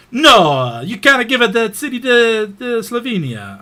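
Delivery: loud, high-pitched mock Italian outburst; Italian accent. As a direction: protest loudly in a hammed-up Italian accent. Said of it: "I just wanted to do an Italian accent".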